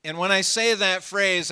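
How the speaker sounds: normal talking voice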